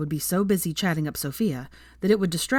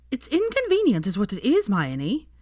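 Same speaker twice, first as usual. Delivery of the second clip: much higher, indignant huff; 6 semitones higher than usual. A narrator's reading. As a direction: grumble at the cold like it's a personal insult. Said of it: "she huffed".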